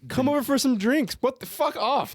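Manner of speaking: high mock voice of the neighbor. Mocking the neighbor's fake invitation, high and scornful. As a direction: mimic him high and scornful.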